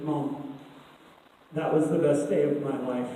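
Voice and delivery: low, soft imitation of a daughter; quiet confiding. Daughter telling mom about her day, quietly confiding. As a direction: drop the voice and let it out softly, pausing after Mom.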